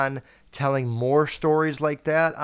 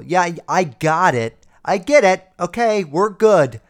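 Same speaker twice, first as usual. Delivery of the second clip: exasperated retort; 3 semitones higher, 6 dB louder than usual. Retold or imitated. Acted fed-up reply to uncle's criticism.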